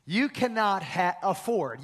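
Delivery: usual speaking voice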